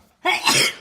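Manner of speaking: sudden loud sneeze; one sharp burst of a sneeze; sneezing. Real, not acted. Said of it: "Sorry for the sneezing".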